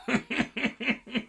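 bright high burst of laughter. Real, not acted. Laughing at Ainz giving in, high laugh. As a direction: let a squeaky laugh out.

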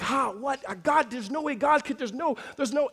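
acting out an outraged, tongue-tied reaction; angry disbelief. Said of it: "I was so angry".

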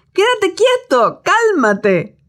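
put-on calm the sea like a child; mild scolding. Jesus telling the sea to settle, mild scolding. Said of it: "En otras palabras".

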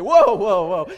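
loud startled whoa over the loudspeaker; alarmed pilot whoa. Retold or imitated. Pastor imitating the pilot's startled whoa, loud. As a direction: cry out like a shaken pilot. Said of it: "you could hear the pilot say".

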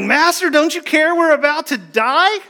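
high, frantic cry of panicked disciples. Retold or imitated. Disciples in the storm wake Jesus in high panic. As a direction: shriek it like terrified men in a sinking boat. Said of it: "they're like, freaking out".

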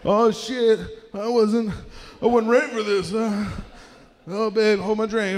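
high, loud mock panic; flustered contestant. Driver called to street games, acting flustered. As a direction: blurt it in playful fluster.

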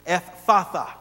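Ephphatha with two hard F sounds back to back; hard double F. Shown as a demonstration. Preacher teaching the congregation a tough word, hard double F. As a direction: stretch the double F in Ephphatha, firmly. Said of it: "two hard F sounds back to back".

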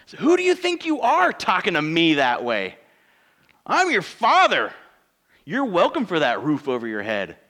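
angry father. Retold or imitated. Pastor voicing himself as an angry father scolding his child. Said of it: "I get angry".

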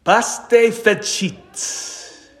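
Paște fericit spoken very slowly, each sound stretched; slow Romanian greeting. Shown as a demonstration. Preacher demonstrating the Romanian Easter greeting, slowly. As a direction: spell out Paște fericit carefully. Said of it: "They will say".

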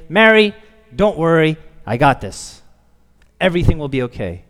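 loud, assured reassurance; great confidence. Pretend. Preacher imagines Jesus reassuring Mary, loudly confident. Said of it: "come in with great confidence and say".

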